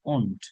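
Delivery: "won't" said low as one drawn "ohnt"; pronunciation of won't. Shown as a demonstration. Teacher showing learners how won't sounds, careful falling pronunciation. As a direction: model "won't" slowly as "ohnt", pitch falling. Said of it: "ওই যে এখানে উচ্চারণ আছে কিন্তু".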